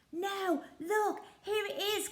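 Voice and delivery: high, excited pirate voice; excited cry. Acted. Peg Polka Dot finding the chest, high and excited. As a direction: call out with glee at the find. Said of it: "cried Peg Polka Dot".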